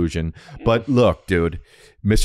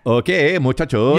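imitated Spanish teacher; 4 semitones higher, slower than usual. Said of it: "but he would go".

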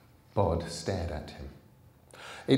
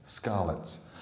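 ordinary delivery, nothing marked

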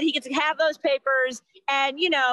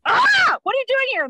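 sudden high yelled "Ah", higher and louder; startled yell; 10 semitones higher, 4 dB louder than usual. Retold or imitated. Startled mom yelling at a nurse who crept in. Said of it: "I'm like".

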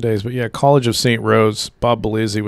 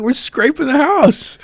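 innocent reply; 4 dB louder than usual. Retold or imitated. Players answering the angry coach.

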